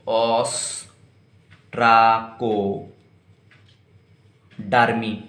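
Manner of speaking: ostracodermy stretched out syllable by syllable; very slow pronunciation. Shown as a demonstration. Teacher dictating ostracodermy very slowly to students. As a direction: drag ostracodermy out so it sticks. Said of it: "एक तो होता है class".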